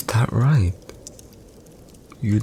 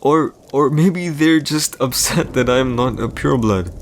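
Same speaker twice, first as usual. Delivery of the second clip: indignant, higher-pitched outburst; losing composure; 4 semitones higher than usual. Acted. Emperor to his captive wife about being scorned as an orphan, losing composure. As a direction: protest with mounting hurt, voice climbing. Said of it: "I lost my composure there".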